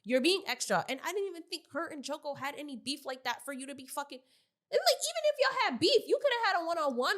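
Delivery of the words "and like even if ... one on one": heated rant. Real, not acted. Heated rant about stomping.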